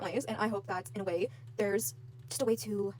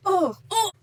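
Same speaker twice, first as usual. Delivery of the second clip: frustrated groan; 9 semitones higher, 9 dB louder than usual. Real, not acted.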